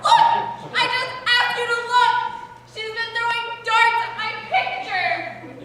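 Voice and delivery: high, loud protest; indignant outcry. Acted. Daughter finding darts in her picture, indignant shrill outcry. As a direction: shriek for them all to look.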